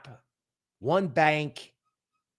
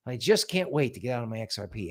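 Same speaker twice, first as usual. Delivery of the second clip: ordinary talk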